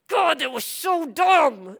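retold fed-up complaint; exasperated venting. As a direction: drag it out in comic frustration. Retold venting to God after service.

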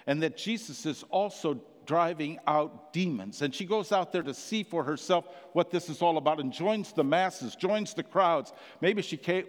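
ordinary talk

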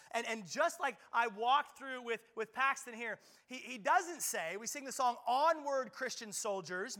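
high, loud, slowing pulpit preaching; preaching at full force. Acted. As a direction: drive the charge home to the congregation. Preaching the armor of God.

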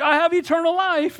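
high, lifted, delighted reminder; bright burst of acted relief, pitched high; joyful realization. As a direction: exclaim it as good news just remembered. Acts out joyful reminder to oneself.

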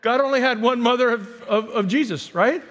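normal talking voice

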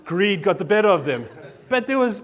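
normal talking voice

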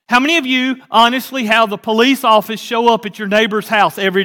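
loud, rising preacher's challenge to the congregation; preaching at full force. Acted. Preacher challenging his congregation, loud and rising. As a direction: drive each question home like a revival preacher.

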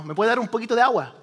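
quick Spanish request. Retold or imitated. Pastor asking for water, quick Spanish request. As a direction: rattle it off fast in Spanish.

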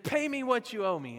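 loud, high retold demand; aggressive demand. Servant grabbing his debtor by the neck, demanding. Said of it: "grabbed this guy by the neck".

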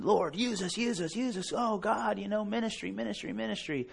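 high, strained pleading prayer; hammed-up eager young zealot begging God; zealous praying. Young men at morning prayer time, zealously pleading. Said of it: "We're zealous".

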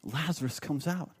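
very soft, low echo of the line; hushed repeat. Real, not acted. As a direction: drop right down and let it sink in. Repeats it hushed after a pause.

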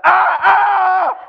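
two loud, high yelps; comic screams of fright; mock frightened screams. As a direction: yelp as if startled by a corpse. Acts fright at moving corpse.

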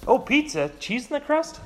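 high, delighted squeal of a cartoon soldier; light up over the pizza; excited about pizza.

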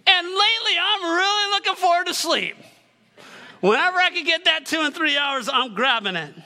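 revved-up sermon delivery; fired-up preaching. Acted. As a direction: preach it with rising excitement. Elevated sermon opening.

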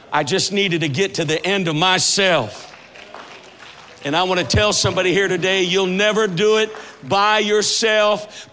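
full-force preaching. Acted. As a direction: rouse the congregation to the grace of God.

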